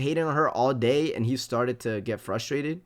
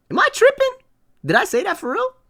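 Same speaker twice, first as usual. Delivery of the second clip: much higher, louder incredulous cry; can't believe what he's reading; disbelief; 6 dB louder than usual. Real, not acted. Streamer asks chat if he really said it, incredulous.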